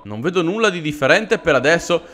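ordinary delivery

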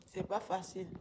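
normal talking voice

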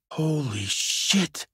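soft, very drawn-out disbelief; stunned. Acted. Investigator takes in a horrible scene, stunned. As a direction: stretch it out slowly, quietly aghast. Second take of shocked reaction.

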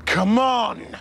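loud, high cry of protest; exasperated outburst. Acted. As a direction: burst out in exasperation.

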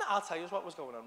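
usual speaking voice

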